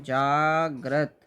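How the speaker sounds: जागृत stretched slowly, जा-गृ-त with each sound clear; very slow spelled word. Shown as a demonstration. Teacher to exam students, dictating the word very slowly. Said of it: "जागृत कैसे लिखते हैं?".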